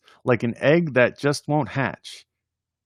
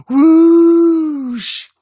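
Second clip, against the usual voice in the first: high loud rushing whoosh; a balloon letting go with a shrieking whoosh; 10 dB louder than usual. Acted. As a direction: blast out the air escaping. Balloon deflating in poem.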